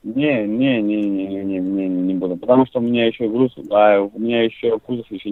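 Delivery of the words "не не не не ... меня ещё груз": normal talking voice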